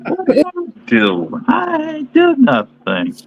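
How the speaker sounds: high, slow whiny protest; whiny little kid. Pretend. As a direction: whine it like a blamed kid. Mock kid denial, high.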